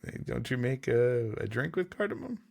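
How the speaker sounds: ordinary talk, nothing marked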